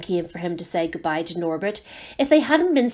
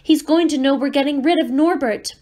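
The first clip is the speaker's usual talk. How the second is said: rising panic, higher and louder; panicked; 6 semitones higher, 4 dB louder than usual. Acted. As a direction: blurt it out in alarm. Ron panics, remembers letter.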